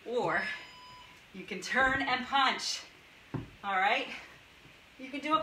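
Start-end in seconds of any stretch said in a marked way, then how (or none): none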